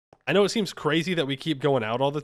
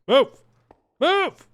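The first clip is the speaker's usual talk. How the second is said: rising, much higher and louder shout; 12 semitones higher, 9 dB louder than usual. Real, not acted. Player yelling at a stuck horse, rising shout. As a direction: yell at the stubborn horse.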